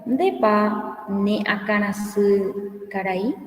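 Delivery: each Guarani word read out slowly and evenly; slow and clear so students can follow; slow model reading. Shown as a demonstration. Teacher reads the Guarani question aloud slowly for the class.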